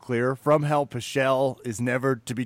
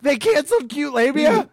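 surprised; 11 semitones higher, 4 dB louder than usual. Real, not acted. Shocked at cancelled fight.

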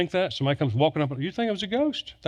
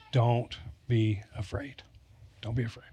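whispered comfort; 4 semitones lower, 7 dB quieter than usual. Acted. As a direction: breathe it out gently like a reassurance. Preacher voices Jesus softly.

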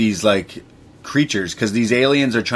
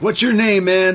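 much higher, mock-excited call; animated retold question; 11 semitones higher, 4 dB louder than usual. Acting out pilots talking mid-escape, animated and high. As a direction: toss it out high, imitating an eager pilot. Acted cockpit exchange during escape.

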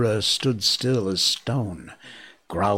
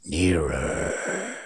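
breathy whispered snake hiss. A narrator's reading. Kaa hissing at the swaying monkeys, whispered hiss. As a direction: hiss it like a hypnotic python. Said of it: "hissed Kaa".

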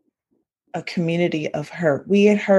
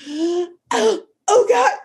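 shocked gasp; 10 semitones higher, much slower than usual. Retold or imitated.

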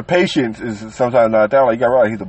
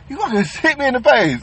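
mock outraged mom; 11 semitones higher than usual. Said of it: "she called me".

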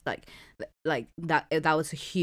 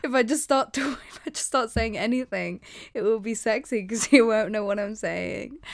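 giggly retold self-talk; 7 semitones higher, 5 dB louder than usual. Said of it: "So I was like".

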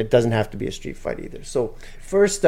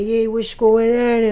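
put-on high, slow old-man voice; greeting from an old man, slow and high; old Nino; 9 semitones higher, slower than usual. Old regular greets Ben at the coffee shop, slow high old-man voice. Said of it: "He's real old".

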